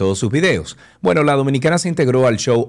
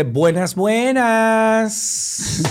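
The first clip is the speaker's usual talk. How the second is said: high, stretched sing-song greeting; Amber Heard's greeting; 13 semitones higher, much slower than usual. Retold or imitated. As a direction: draw out the second buenas way up high. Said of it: "si vamos a decir como ella dice".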